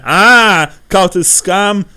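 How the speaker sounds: loud, high furious accusation; accusing innkeeper. Acted. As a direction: spit out the accusation at the foreigner. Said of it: "says the innkeeper".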